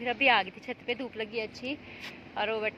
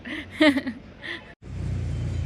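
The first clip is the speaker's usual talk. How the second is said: exasperated laugh; 5 semitones higher than usual. Real, not acted. Annoyed at the rice, laughs.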